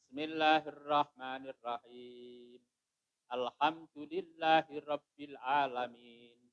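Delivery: measured Fatihah recitation; Quran recitation. Shown as a demonstration. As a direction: recite each verse evenly, drawing out the last syllable.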